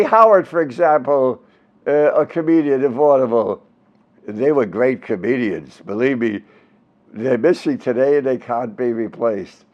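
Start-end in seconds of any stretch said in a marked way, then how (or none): none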